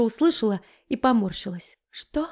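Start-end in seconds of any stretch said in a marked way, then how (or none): 1.9-2.3 breathless whispered question; dazed bride whispering back; whispered what. Acted. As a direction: barely whisper it.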